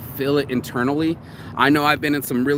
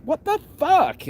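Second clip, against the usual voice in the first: mock startled; 14 semitones higher, slower than usual. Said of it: "she's like".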